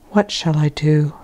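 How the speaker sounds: breathy unvoiced whisper to herself. A narrator's reading. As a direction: whisper it, lost and alone. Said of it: "whispering to myself over and over again".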